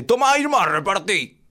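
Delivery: high, loud proud dad voice; father handing over juice. Retold or imitated. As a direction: announce it like a big gift. Papá le da el jugo. (papá orgulloso, agudo y fuerte)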